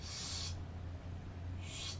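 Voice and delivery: soft, isolated hushing sh; sh sound shown. Shown as a demonstration. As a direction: give the bare sh sound, quietly. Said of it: "you pronounce it as".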